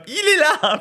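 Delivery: very high pointing cry; hammed-up accusation; accusing cry. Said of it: "elle est comme". (cri accusateur très aigu)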